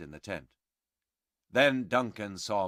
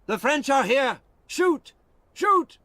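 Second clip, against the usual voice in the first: high, loud alarm call; loud high alarm shout; 7 dB louder than usual. Acted. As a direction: yell the alarm from the wall. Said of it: "An English voice shouted".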